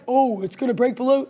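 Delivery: the pessimist in her head, lightly voiced; trader's inner bear muttering a mild warning. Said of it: "I don't know why I keep doing that voice".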